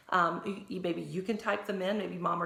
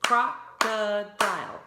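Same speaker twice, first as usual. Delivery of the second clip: "Crocodile" slow and loud, croc-o-dile; "Crocodile" chanted in claps; clapped syllables; 2 semitones higher, 9 dB louder, much slower than usual. Shown as a demonstration. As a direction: beat out "Crocodile" syllable by syllable, loudly. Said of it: "Three claps".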